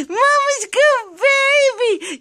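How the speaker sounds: put-on "Mama's good baby" high and slow, stretched on "good"; high sing-song. Shown as a demonstration. Owner modelling a phrase for her parrot, high sing-song. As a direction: sing it up high for the bird to copy. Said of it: "let's do it again".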